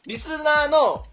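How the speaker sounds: リスナーの stretched out high and loud; laboured pronunciation. Real, not acted. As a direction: struggle through リスナーの, slow and overdone. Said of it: "どんだけ言いにくいの？". (言いにくそうに高く伸ばしたリスナーの)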